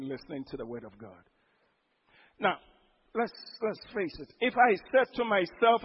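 usual speaking voice

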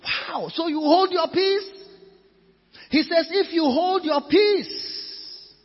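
loud, high, forceful exhortation; slow, punched preacher's declarations; full-force preaching. Real, not acted. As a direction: cry it out to the congregation.

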